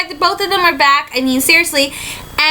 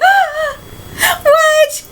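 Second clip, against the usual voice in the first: excited shriek; 12 semitones higher than usual. Retold or imitated. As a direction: gasp then squeal his name. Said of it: "I'm like, gasps".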